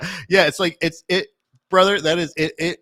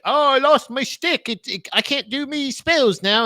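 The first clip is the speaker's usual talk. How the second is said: higher, slow, doddering wizard; mock wizard; 9 semitones higher than usual. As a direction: whine it like a muddled old wizard. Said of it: "the wizard goes like".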